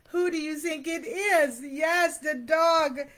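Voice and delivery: usual speaking voice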